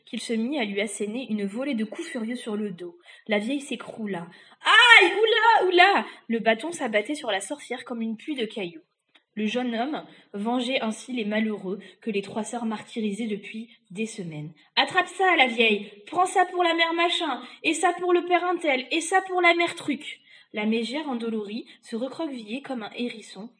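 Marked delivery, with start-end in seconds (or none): 4.6-6.1 very loud high wails; yelps of pain. Acted. Witch beaten with a stick, very loud high yelps. 14.8-20.2 loud, high blow-by-blow shouts; vengeful shouting. Acted. Young man beating the witch for her victims, vengeful shouts.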